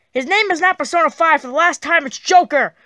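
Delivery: loud, high-pitched fed-up outburst; irritated shout, setting someone straight; exasperated correction. Acted. Exasperated correction of a repeated mistake.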